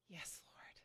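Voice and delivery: very soft, nearly voiceless answer; hushed reply. Acted. Blind men answering Jesus, hushed and whispery. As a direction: answer under your breath, humbly.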